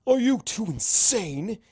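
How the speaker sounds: high, slow, incredulous hiss; shocked angry hiss. A narrator's reading. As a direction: hiss it at them in disbelief. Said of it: "he hisses".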